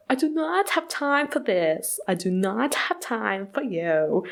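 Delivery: put-on slow, haughty German heiress accent, lightly done. Vlogger imitating Anna Delvey's German accent, haughty and slow. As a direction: drawl it like a bored German heiress. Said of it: "weird accent like".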